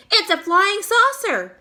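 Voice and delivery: high, loud excited shout. A narrator's reading. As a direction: yell it up at the sky in wonder. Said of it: "they shouted".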